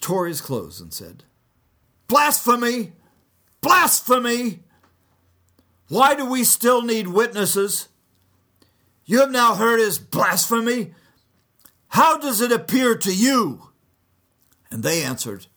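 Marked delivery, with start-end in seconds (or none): 2.1-13.7 high, loud outraged shout, the second one slower; outraged high priest. Acted. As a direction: scream the charge of blasphemy. High priest tears his clothes.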